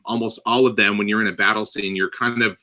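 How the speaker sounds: ordinary talk, nothing marked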